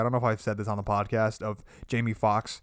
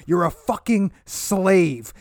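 mock stern scolding; 7 semitones higher, 5 dB louder, slower than usual. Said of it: "He's like".

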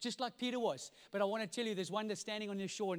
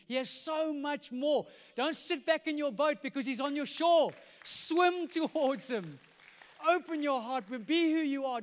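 loud, high urgent exhortation; impassioned preaching; 6 semitones higher, 8 dB louder than usual. Real, not acted. Preacher urges people to swim towards Jesus, impassioned. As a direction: drive each appeal home, building in force. Exhorts the congregation at full force.

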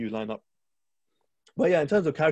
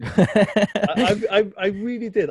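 laughing; 9 semitones higher, 9 dB louder, much slower than usual. Real, not acted. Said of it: "S1 laughs".

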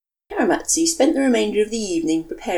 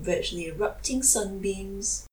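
ordinary delivery

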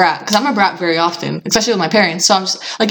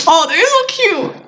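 cooing; 11 semitones higher, much slower than usual. Real, not acted. Cooing at a cute picture.

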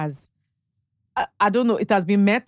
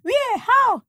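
very high, louder, alarmed questions; shocked questions; 4 dB louder, much slower than usual. Retold or imitated. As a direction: react in shock to the fall. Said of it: "I said".

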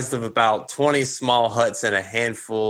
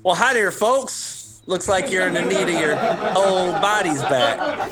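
put-on old shopkeeper; 8 semitones higher than usual. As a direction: play a friendly toothless junk dealer. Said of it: "He says".

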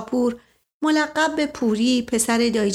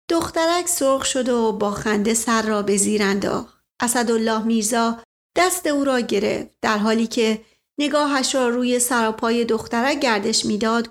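usual speaking voice

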